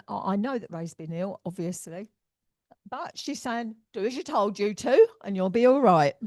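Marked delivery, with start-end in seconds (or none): 3.9-6.1 loud, forceful telling-off; fiery. Retold or imitated. Medium relays a spirit lady's order to her client, fiery. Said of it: "Bit fiery in that sense".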